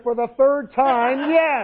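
high, loud, slow, hammed-up exasperation; exasperated shout. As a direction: shout it as the fed-up wife. Wife snaps at repeated question.

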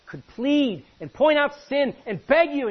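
high, loud, slow pleading; pleading preacher. Acted. Pastor at the front pleading with his people, loud and slow. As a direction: plead with the congregation at full force.

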